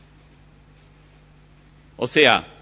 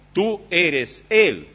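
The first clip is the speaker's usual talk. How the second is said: "tú eres él" slow, higher, with "él" leaned on hard; slow stress on él; 6 semitones higher, 4 dB louder, much slower than usual. Shown as a demonstration. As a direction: drag out "tú eres él" and land on "él". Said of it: "O sea".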